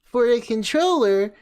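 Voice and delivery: mocking whiny voice. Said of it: "This fucking voice".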